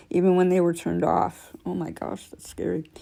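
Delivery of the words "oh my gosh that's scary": ordinary delivery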